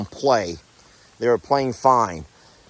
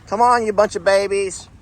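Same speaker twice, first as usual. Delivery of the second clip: higher, sing-song voice for animals; 9 semitones higher than usual. Real, not acted. Farmer greeting her goats at the barn in higher cooing baby talk. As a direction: coo at the goats like little babies.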